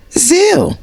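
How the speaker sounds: very high mocking squeal; mimicking Martell. Mimicking his answer to Mel, high and mocking. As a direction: squeal the one word in ridicule. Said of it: "what did he do?".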